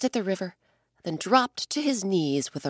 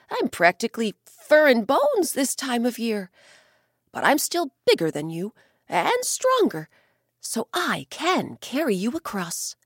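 confident bear; 4 semitones higher than usual. Acted. Bear telling the old man it can still carry him, higher. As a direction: boast of strength with a smile.

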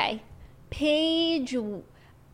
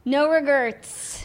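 slow, proud No regrets; dramatic echo; 3 semitones higher, 3 dB louder, much slower than usual. Acted. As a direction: drawl No regrets like a motto. Echoing the book line.

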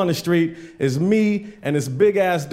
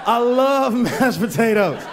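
high, slow, laughing; 6 semitones higher than usual. Retold or imitated. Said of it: "And I was like".

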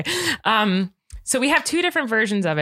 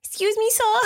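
put-on squeaky high little-kid voice, tossed off as a joke; mock a toddler politely asking a stranger; baby voice; 12 semitones higher than usual. Shown as a demonstration. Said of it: "I don't wanna talk like a baby".

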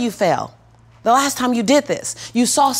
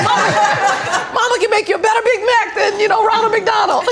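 much higher, louder, laughing playful boast; laughing reply; 11 semitones higher, 6 dB louder than usual. Retold or imitated. Mother answers her son about Big Macs, much higher, laughing. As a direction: tease him through giggles. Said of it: "I was like".

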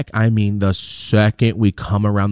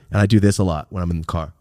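ordinary delivery

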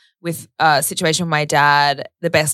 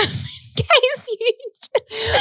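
laughing; slower than usual. Real, not acted. Laughing about fainting.

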